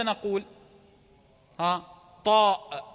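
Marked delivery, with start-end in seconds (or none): 2.2-2.8 "طاء" with a firm final glottal stop; hamza pronounced. Shown as a demonstration. Teacher naming the letter for students, hamza pronounced. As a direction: close "طاء" on an audible hamza. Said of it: "ما الذي حذف؟".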